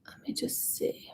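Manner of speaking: soft unvoiced whisper to herself; whispered aside. Real, not acted. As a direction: murmur it under your breath. Aside while checking questions.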